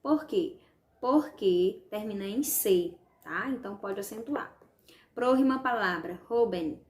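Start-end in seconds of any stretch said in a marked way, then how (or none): none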